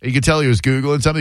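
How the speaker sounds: usual speaking voice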